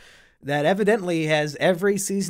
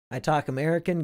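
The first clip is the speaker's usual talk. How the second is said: ordinary talk, nothing marked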